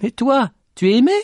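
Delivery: very high, loud question; calling out. Acted. Petit Jean asks the lady if she is loved, calling out. Shouted exchange resumes after laugh.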